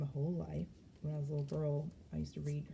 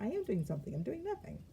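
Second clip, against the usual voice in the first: gleeful repeat; 7 semitones higher than usual. Retold or imitated. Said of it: "I was like".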